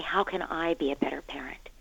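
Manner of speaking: soft whisper; whispered prayer. Pretend. Parent asking God for guidance, in a hushed whisper. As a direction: breathe the question to God in a whisper.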